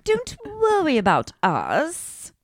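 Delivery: put-on high, slow prim child on the phone; mock the kids' put-on British manners, high and drawn out; imitating a prim little British child; posh kid voice. Mocking Dorit's kids on phone.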